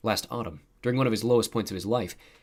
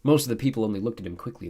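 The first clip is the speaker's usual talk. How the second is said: usual speaking voice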